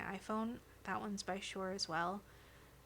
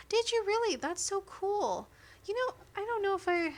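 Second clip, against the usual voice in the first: excited; 11 semitones higher, 9 dB louder than usual. Real, not acted. Excited reaction to friend's news.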